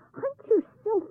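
high, impatient shout. A narrator's reading. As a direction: snap the order at the boy. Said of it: "she shouted".

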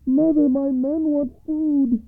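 high, loud, slow call; youngest son crying out. A narrator's reading. Youngest son to his mother over the wall, high loud cry. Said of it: "cried the youngest son".

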